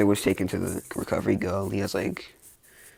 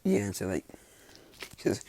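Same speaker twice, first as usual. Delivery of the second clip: ordinary delivery, nothing marked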